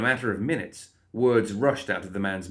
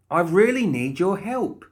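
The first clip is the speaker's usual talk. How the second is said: high, louder, slow appeal; insistent plea; 11 semitones higher, 6 dB louder than usual. Acted. Molly needing the guard's help, pleading insistently. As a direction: urge it slowly, pitched up.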